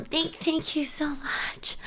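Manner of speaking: whispered thanks while crying; tearful whisper. Real, not acted. Thanking her chat while crying, whispered. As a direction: choke out a soft tearful thank you. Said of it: "lost when I'm crying".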